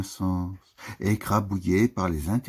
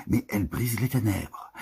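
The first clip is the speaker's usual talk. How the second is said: normal talking voice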